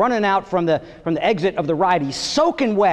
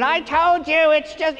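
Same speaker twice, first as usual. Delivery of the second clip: put-on kids copying the line; 6 semitones higher, slower than usual. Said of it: "the kids are doing".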